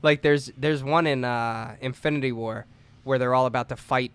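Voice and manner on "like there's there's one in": normal talking voice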